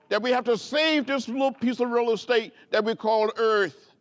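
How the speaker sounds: stirring public address; rousing oratory. Acted. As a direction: preach it to the crowd, then bring it home. Rising oratory, civil rights speech.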